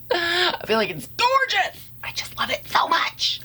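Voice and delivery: high excited whisper. Real, not acted. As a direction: gush about it in a breathy whisper. Gushing over own eye look.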